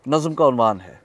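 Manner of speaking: ordinary delivery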